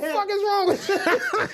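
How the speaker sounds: high, slow, laughing question; laughing exasperation. Retold or imitated. Telling friend off in retold traffic stop, high and laughing. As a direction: crack up while scolding your friend.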